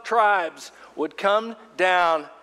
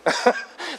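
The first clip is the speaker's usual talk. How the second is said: laugh; 9 semitones higher than usual. Real, not acted. Laughing at his own rage.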